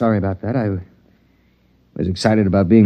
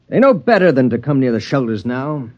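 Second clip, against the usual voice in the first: ordinary talk, nothing marked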